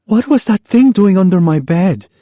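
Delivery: high, upset mother's question; mother pleading for an explanation; dismayed mother. Acted. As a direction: demand an answer, shaken.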